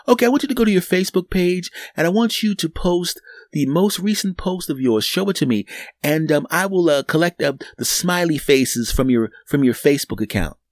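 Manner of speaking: a hint of a chirpy teller. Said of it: "teller voice".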